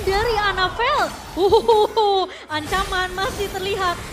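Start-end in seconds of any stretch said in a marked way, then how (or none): 1.3-2.2 loud delighted laugh; excited laugh. Real, not acted. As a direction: burst out laughing at the play. Co-caster laughs at play. (tawa keras bersemangat)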